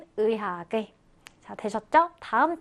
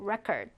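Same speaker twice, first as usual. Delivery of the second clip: "record" said REC-ord, falling after the first syllable; stress on first syllable; 3 semitones lower, 7 dB quieter than usual. Shown as a demonstration. Teacher repeats the noun form, first syllable of "record" stressed. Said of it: "강세가 앞음절에 있죠".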